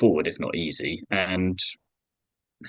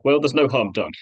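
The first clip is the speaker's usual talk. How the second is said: airy, raised voice of a driver shrugging off a crash; breezy loud "no harm done"; mock innocent driver; 7 semitones higher, 6 dB louder than usual. Driver brushing off stewards, in a breezy mock voice.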